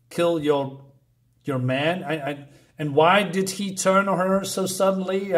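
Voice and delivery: normal talking voice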